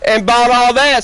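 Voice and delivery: ordinary talk, nothing marked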